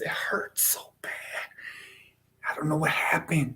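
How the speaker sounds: breathless whisper of mock pain. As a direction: whisper it like it really hurts. Pretends to have chopped pinky.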